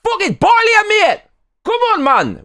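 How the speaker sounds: screaming Geordie, very high and loud; shrieking Geordie lad picking a fight; Geordie shout. Pretend. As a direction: rage like a drunk Geordie football fan. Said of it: "do a few impressions".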